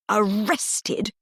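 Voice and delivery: spitting the word in anger; spat out. A narrator's reading. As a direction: spit it out with contempt. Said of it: "he spat the word out".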